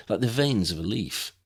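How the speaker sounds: ordinary delivery, nothing marked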